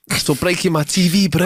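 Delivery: bad South African accent on the quote; a clumsy South African twang. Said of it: "the worst South African accent".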